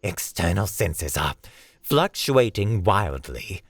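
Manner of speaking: tense, emphatic report over comms; a touch of strain reporting over the radio. Acted. Ilarion reports wild suit readings to the team, strained. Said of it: "tinny and strained".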